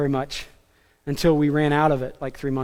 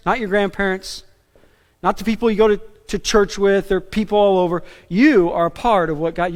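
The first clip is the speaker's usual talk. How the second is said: normal talking voice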